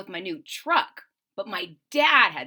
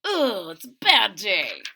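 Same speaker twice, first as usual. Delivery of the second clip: louder, fed-up grumble; 6 dB louder than usual. Acted. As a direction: grumble it like a sulking kid. Reader voices critter's frustration.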